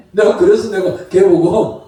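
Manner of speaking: usual speaking voice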